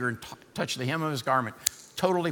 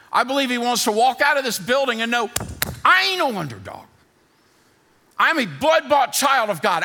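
high, loud, building preacher's cry; full-force preaching; 6 semitones higher, 6 dB louder than usual. Acted. Pastor firing up the congregation in a loud preaching cry. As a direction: preach it out to the whole room.

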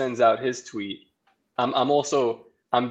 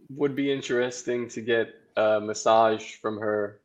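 normal talking voice

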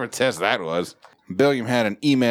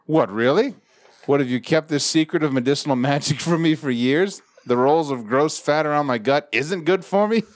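higher-pitched, heavily sarcastic gratitude; mock amazement; 5 semitones higher than usual. Reading a patient's reply to the doctor, sarcastic amazement. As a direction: gush with sarcastic disbelief, pitched up.

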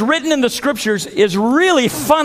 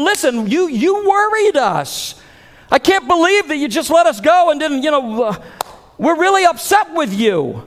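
raised pitch, scolding parents; 5 semitones higher than usual. Retold or imitated. Joseph and Mary telling off lost Jesus, mock angry. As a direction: scold the boy like a worried parent.

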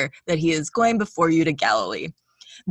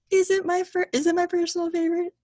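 high squeaky question; 12 semitones higher than usual. Pretend. Mock-asking her own line back.

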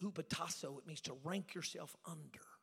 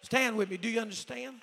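very loud, raised preacher's cry; full-force preaching; 9 semitones higher, 23 dB louder, slower than usual. Real, not acted. Preacher rallying the church to stand with him, full-force preaching. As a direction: preach it out over the crowd.

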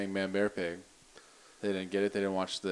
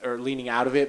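ordinary talk

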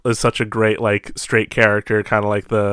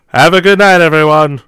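loud, raised showman's farewell; called-out sign-off; 5 semitones higher, 8 dB louder than usual. Pretend. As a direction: call it out to the whole crowd.

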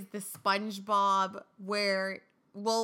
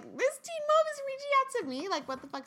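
shocked disbelief. Retold or imitated. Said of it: "so I was like".

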